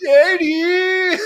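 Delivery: high, loud call of a name; loud exasperated holler. Real, not acted. Calling out Eddie's name.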